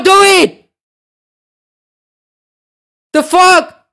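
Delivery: high-pitched, indignant yelling; furious pleading. Real, not acted. Streamer orders viewer to delete username, furious high shouting. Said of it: "Y'all making me so mad".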